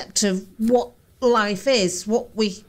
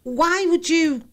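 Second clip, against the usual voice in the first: ordinary delivery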